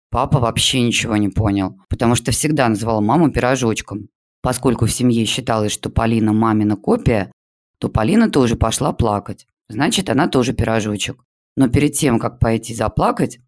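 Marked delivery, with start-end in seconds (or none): none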